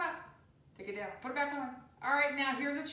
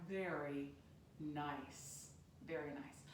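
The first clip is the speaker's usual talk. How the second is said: soft; 9 semitones lower, 12 dB quieter, slower than usual. Shown as a demonstration. Calm praise after breathing.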